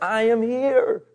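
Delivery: high, drawn-out insistence; pleading Jesus. Retold or imitated. Jesus crying with the mourners, insistent plea. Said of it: "he's crying with them 'cause he's like".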